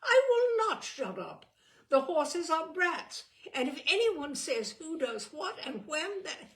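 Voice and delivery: high loud indignant protest; defiant. A narrator's reading. Eleanor defying her brother, loud and indignant. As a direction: stand up to him at full voice.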